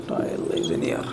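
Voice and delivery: whispered repeat of Projectile is in air. Shown as a demonstration. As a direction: breathe the words Projectile is in air to yourself. Teacher repeats definition under breath. (फुसफुसाकर दोहराते हुए)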